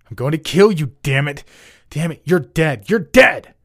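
high, loud furious yelling, building to a peak; enraged scream. A narrator's reading. Student screams at the girl he's attacking, in rage. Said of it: "he screamed in rage".

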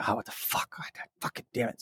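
hushed, voiceless retold cursing; frustrated muttering. Gamer watching the GPU throttle, whispered frustration. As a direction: swear under your breath, almost whispering. Re-enacting frustration at failed repair.

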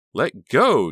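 high, loud scream. A narrator's reading. Honey screaming at Bill during the knife struggle. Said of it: "she screamed".

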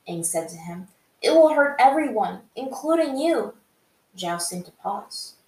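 dramatic fan fiction narration; story narration. A narrator's reading. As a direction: read the chapter out like an audiobook. Reads the fan fiction chapter.

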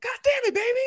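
very high, strained mock yell; imitating a fed-up dad; exasperated father. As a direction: squeal it in put-on frustration. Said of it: "so he's like".

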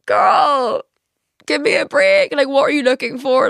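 put-on high, loud exasperated girl; exasperated sass. Telling the ex off for going through her phone, sassy. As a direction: give me a break, with attitude. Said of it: "And I was like".

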